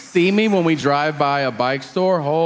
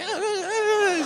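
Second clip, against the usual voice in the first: put-on high, slow, soft teen groans; whiny groans; 5 dB quieter, slower than usual. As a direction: groan like a sulky kid. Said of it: "And the kid's like".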